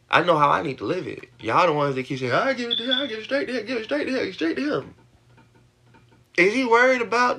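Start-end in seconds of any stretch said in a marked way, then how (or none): 2.3-4.9 high mocking sing-song quote; a mocking echo of viewers, higher. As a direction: mimic them, pitched up and repetitive. Mocking what viewers keep saying.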